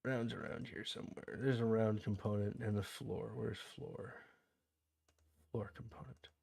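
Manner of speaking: hushed mumble, fading toward a whisper; very soft, absent-minded self-talk; muttering to oneself. Real, not acted. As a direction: think aloud under your breath while searching. Muttering to himself while searching.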